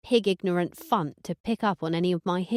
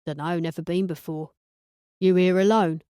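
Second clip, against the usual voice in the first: lad voice; 2 semitones lower, 3 dB louder than usual. Acted. Conan replies, lad voice.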